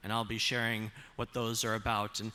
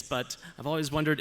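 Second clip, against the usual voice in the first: usual speaking voice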